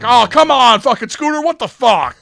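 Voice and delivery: very high, loud whiny protest; kids' mock outrage. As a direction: groan at the teammate like frustrated teens. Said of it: "they're like".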